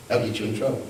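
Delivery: ordinary talk, nothing marked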